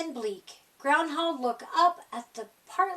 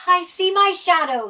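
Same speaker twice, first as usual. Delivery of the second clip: announcing; 3 semitones higher, 8 dB louder than usual. Acted. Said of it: "he announced".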